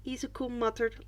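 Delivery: ordinary delivery, nothing marked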